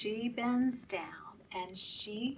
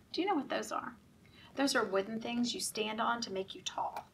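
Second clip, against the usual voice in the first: plain reading voice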